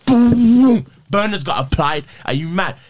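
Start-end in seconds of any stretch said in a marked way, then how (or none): 0.0-0.8 loud, high drawn-out "boom"; explosive boom. Retold or imitated. Bolting as soon as he turned the corner, explosive boom. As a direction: burst it out like a sudden getaway. Imitates sudden sprint away.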